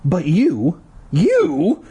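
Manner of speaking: high, halting disbelief; acted stammer of shock. A narrator's reading. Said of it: "Ashley stammered".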